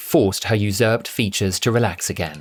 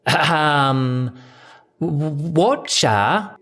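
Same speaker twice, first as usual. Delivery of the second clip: halting fake Cockney accent; stammered Cockney; 7 semitones higher, much slower than usual. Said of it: "she stammered ... approximation of a Rose accent".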